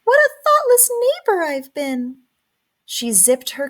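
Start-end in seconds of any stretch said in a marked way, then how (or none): 0.0-2.2 high little mouse voice, a bit louder; a tiny mouse scolding herself; high, squeaky self-reproach. A narrator's reading.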